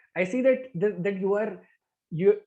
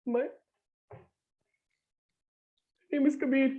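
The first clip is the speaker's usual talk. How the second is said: sad; 5 semitones higher than usual. Shown as a demonstration. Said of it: "do it with sadness".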